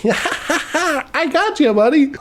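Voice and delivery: high, drawn-out gloating tease; put-on high gleeful voice; mock cackling. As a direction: imitate a prankster crowing after a cackle. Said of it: "Jeffrey starts cackling".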